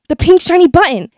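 shocked and loud; sudden shock at seeing it; surprised. A narrator's reading. Shocked at finding button.

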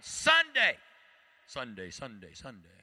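booming announcer Sunday Sunday Sunday; monster truck ad. Acted. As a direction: hype it like a monster truck rally ad. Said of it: "Sound like a monster truck rally commercial".

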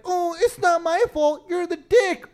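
high mock-defensive voice; mocking imitation. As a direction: mimic a blame-dodger in a high voice. Mimics someone deflecting blame.

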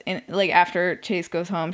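ordinary delivery

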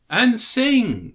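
high, loud echo; emphatic exclamation. Real, not acted. Co-host seizing on a friend's point, excited exclamation. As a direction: throw it back high and emphatic. Echoes co-host's addition, emphatically.

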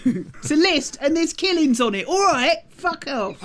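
high, hammed-up laddish voice; mock bloke voice. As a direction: bark it out as a cartoon geezer. Joining the mock producer voice.

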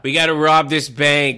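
loud, slow mock Boston robber; Boston heist movie voice. Host parodies The Town, loud mock Boston crook. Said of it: "there is a Boston action film".

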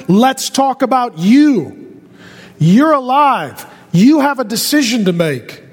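emphatic preaching. Real, not acted. Preacher confronts congregation about their own decision, emphatic preaching. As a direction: preach it straight at them, weight on each word. Preacher presses the answer home.

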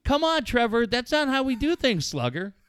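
mock the gentle coaxing parent; mock soft dad. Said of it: "who's gonna say".

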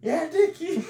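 high, loud mock holler; shouting across party. Dad answers Dickie across the party, mock shouting. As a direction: imitate yelling back, with a laugh creeping in. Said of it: "Why are they shouting across the party?".

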